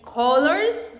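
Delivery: colors with a hard k, drawn out; strong C. Shown as a demonstration. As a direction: stretch colors from a firm k start. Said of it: "when we say strong here".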